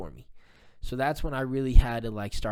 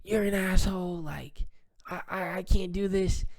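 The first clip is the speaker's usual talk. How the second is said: higher-pitched imitation of a woman losing her temper; mock flipping out; 8 semitones higher than usual. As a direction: toss off her outburst, pitched up. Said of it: "she flips out".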